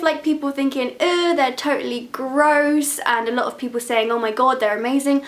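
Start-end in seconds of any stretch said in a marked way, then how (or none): none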